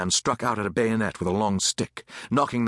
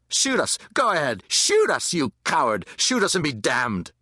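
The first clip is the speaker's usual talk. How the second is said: high-pitched defiant goading; loud daring shouts; 5 semitones higher than usual. A narrator's reading. Angry mob goads an armed soldier, shouting. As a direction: dare them, each cry starting high. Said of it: "the voices were louder still".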